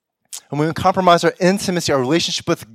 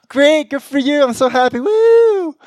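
high, loud forced cheer ending in a whoop; mock happy cheer; 11 semitones higher, 5 dB louder than usual. Hearing friend got job, forced excited cheer. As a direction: cheer brightly for a friend while hiding envy.

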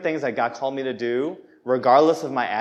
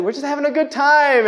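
high, loud pleading protest; mock whiny protest; defensive protest; 13 semitones higher, 6 dB louder than usual. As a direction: squeak it out in defence. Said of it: "And you're like".

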